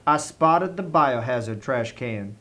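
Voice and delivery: ordinary delivery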